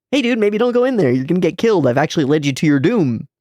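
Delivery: struggling Irie. Retold or imitated. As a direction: push the warning out nervously and high. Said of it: "Irie struggles to say".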